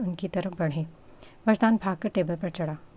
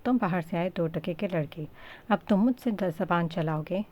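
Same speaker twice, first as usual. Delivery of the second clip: ordinary delivery, nothing marked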